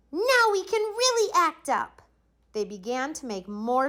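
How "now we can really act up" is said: put-on gleeful kids. A narrator's reading. Kids plotting mischief.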